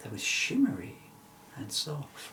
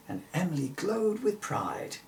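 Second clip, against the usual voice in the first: usual speaking voice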